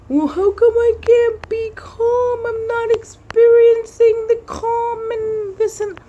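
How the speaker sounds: high, slow, crying whine of frustration; mock whiny cry. Venting to herself about meditation, high crying whine. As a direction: wail it like a frustrated kid.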